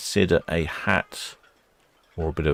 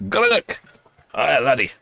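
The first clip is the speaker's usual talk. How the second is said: high, loud mock Scottish Greenock!; hammed-up Scottish Greenock! and Aye, laddie; Scottish accent; 9 semitones higher, 4 dB louder than usual. Vlogger correcting her Greenock in a mock Scottish accent. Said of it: "shouldn't do accents".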